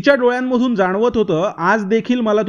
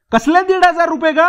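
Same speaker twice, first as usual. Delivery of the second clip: high, louder outraged disbelief; indignant question; 9 semitones higher, 3 dB louder than usual. Acted. A friend denies owing Vrushali money, indignant. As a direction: deny it with indignation.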